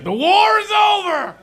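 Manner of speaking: very high, loud victory cry; triumphant shout. Pretend. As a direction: shout like the war just ended. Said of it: "it's like".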